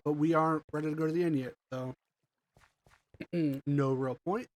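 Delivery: ordinary delivery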